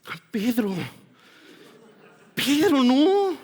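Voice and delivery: a high, slow, drawn-out, pained "no"; exasperated Jesus. Retold or imitated. Jesus stopping Peter after the ear is cut, slow pained plea. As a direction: stretch the second "Pedro" in weary disbelief.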